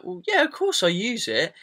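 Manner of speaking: high-pitched, politely insistent answer; polite reply. Retold or imitated. Product maker answering a customer's question, polite reply. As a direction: act out a courteous reassurance. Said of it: "I'm polite and I'm like".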